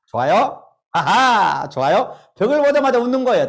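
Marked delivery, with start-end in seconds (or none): 0.9-1.7 loud demo laugh; two big ha has, full of air; hearty ha ha. Shown as a demonstration. Lecturer leading the crowd in a loud laughing exercise. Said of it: "따라합니다".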